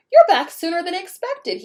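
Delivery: high, loud startled greeting; surprised principal. Acted. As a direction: blurt it out in surprise.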